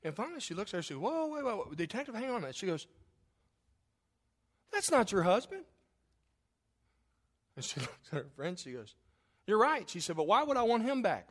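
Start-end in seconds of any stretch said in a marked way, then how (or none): 1.0-2.6 startled interruption. Retold or imitated. Friend interrupts the detective. 4.7-5.6 incredulous objection. Retold or imitated. Friend objects in the joke. 9.5-11.3 mock defiant wife. Wife's punchline in the joke.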